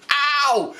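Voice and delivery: high drawn-out ow; acted cry of pain; pained yelp. Waxing victim reliving the pull, high yelp.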